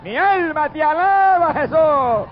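high, ringing cry of praise to Jesús; fervent cry of praise to Jesús; ecstatic praise. Real, not acted. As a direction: lift the praise up, slowly and fervently.

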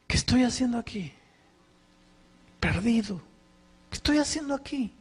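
high, pleading inner cry of a lost man; despairing thought. Retold or imitated. Lost pastor alone in the rain, pleading near tears. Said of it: "me dio unas ganas de llorar porque pensé".

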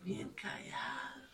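whispered, very low and soft; quiet prayer. Retold or imitated. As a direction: copy his hushed praying. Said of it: "Y cuando ora, igual". (susurro muy bajo, como el esposo rezando)

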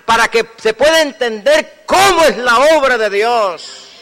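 high, loud sermon delivery; full-force preaching. Real, not acted. Preacher tells congregation God's work is simple, fervent preaching.

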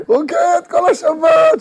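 high, slow loud call; drawn-out shout. Acted. Whole Shabbat dancing.

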